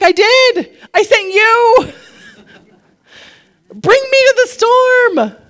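high, loud, exasperated shout back; emphatic exclamation. Acted. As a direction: cry it out as the sermon's rousing climax. Acts God's emphatic answer, climax.

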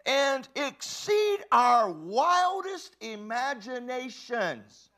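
loud, high, slow, drawn out; preaching at full force. Real, not acted. Preacher thundering scripture to the congregation. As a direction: preach it with all you have.

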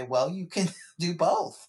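laughing reassurance. Retold or imitated. Therapist laughing as she tells a student both are fine. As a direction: chuckle the permission out to a client. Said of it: "And I say".

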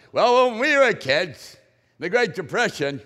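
hammed-up Depression-era parent; mock old parent. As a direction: play the parent who survived hard times.

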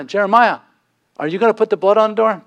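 calling out loud and high over the fence, quickly; urgent call to the neighbor to mark his door. Acted. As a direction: shout across to the neighbor next door.

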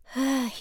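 weary audible sigh. A narrator's reading. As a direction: let out a heavy sigh. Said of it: "He sighed".

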